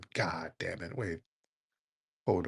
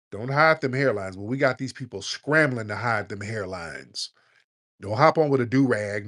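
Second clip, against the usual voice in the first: normal talking voice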